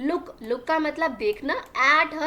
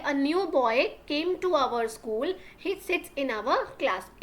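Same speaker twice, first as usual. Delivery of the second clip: very slow, word by word for learners; slow classroom dictation reading; slow read-aloud; much slower than usual. Shown as a demonstration. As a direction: spell the sentence out slowly for the class. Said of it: "teacher reads the full line".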